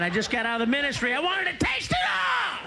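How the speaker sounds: rising to a high shout; greedy outburst. Retold or imitated. Comic justifying his affairs, building to a high shout. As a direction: shout out the craving to taste everything.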